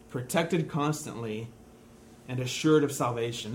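slow repeat of the definition with pauses between phrases; said slowly again. Shown as a demonstration. Preacher repeats the definition to congregation, slowly. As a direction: lay each phrase down slowly a second time. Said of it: "I'll say it again".

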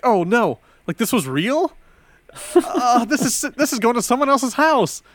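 high, loud panic; sudden alarmed realization; panicked. Retold or imitated. Panicked realization of wrong address.

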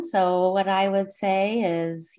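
normal talking voice

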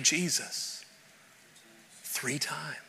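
hushed reveal of Peter's denial; whisper. Real, not acted. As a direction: drop it to a whisper for weight. Sermon, dramatic whisper.

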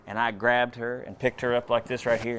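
ordinary delivery

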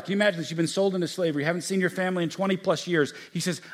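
ordinary delivery

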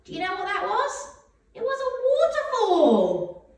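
high singsong voice; reading to a toddler. Acted. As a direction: sing the answer to a little one.

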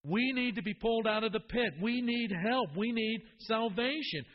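fervent preacher building up; preaching at full force. Acted. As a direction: drive home our need for rescue. Preaching climax on need for rescue.

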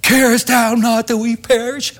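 high, loud mock terrified cry; terrified plea. Preacher acting the terrified disciples begging Jesus, high and loud. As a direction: wail it like frightened disciples. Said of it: "and they are terrified".

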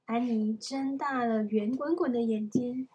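plain reading voice

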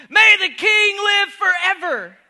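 loud, high and triumphant proclamation; triumphant shout. Acted. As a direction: cry it out as Daniel, victorious. Preacher shouts Daniel's reply.

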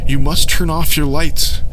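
Aaron begging the crowd; desperate scream. Acted. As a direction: drag each word out in alarm. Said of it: "Aaron screamed".

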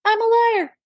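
high, cheeky admission; high mock confession. Mom answering her daughter, high and cheeky. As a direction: squeak it out deadpan.

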